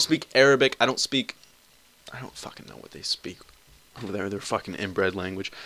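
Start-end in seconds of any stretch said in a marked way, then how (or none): 2.1-5.5 dropped to a near-whispered grumble; muttered aside. Real, not acted. Vlogger grumbling aside to his listeners, hushed. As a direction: mutter it under your breath.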